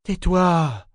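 sharp, exasperated order; irritated. Acted. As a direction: shut him up with annoyance. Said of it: "lui dit Ambre sur un ton irrité".

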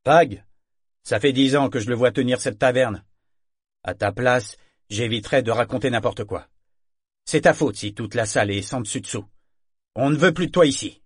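éclate fort, plus aigu, outré; chasse-la d'ici, en colère; réplique indignée. Acted. (le marin renvoie Althea de la taverne, en colère et fort)

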